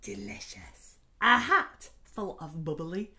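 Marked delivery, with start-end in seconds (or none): 0.0-1.0 breathy whisper, low; whispered delicious. A narrator's reading. Narrator teasing children about tasty beans, whispered. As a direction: savour the word in a hush. Savouring the smell.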